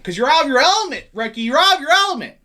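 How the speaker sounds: loud, high-pitched movie-quote outburst; mock-angry shout. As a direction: holler the catchphrase at a friend. Shouts a film catchphrase at friend.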